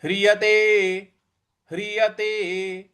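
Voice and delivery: slow, careful ह्रियते, each syllable drawn out; slow model recitation; slow syllable demo. Shown as a demonstration. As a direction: spell out ह्रियते slowly for the class. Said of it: "अगला पद है".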